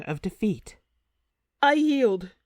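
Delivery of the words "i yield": sharp high cry of surrender; a warrior queen screaming her surrender; screamed. A narrator's reading. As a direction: cry out the yield, high and pained. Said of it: "she screams".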